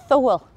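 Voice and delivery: "Thole" said the Ulster Scots way; Ulster Scots word. Shown as a demonstration. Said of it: "Ulster Scots words and phrases I'd like you to listen out for".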